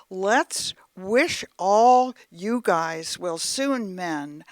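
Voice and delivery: lively sing-song poem reading; limerick recital. Acted. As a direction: recite it with a jaunty beat for a grandson. Reads second limerick aloud.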